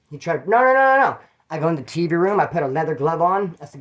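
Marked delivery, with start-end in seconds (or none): 0.5-1.2 high, loud string of noes; imitated panicky refusal. Cellmate refusing to fight, mock panicky. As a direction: mimic someone backing out of a fight.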